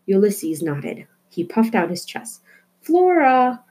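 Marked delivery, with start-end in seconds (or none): 2.8-3.6 a loud call up the stairs, pitched high; mother's shout. A narrator's reading. Mother calling Flora downstairs for dinner, loud and high. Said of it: "her mother shouted".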